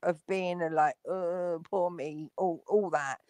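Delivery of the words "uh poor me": slow, soft mock whining; mock self-pity. Imitating the streamer's victim game, mock self-pity. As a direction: play the poor victim, dragged out quietly. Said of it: "He does a real".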